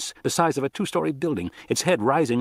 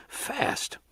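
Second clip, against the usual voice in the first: whisper; 14 semitones higher, 8 dB quieter than usual. Acted. Stunned whispered thought.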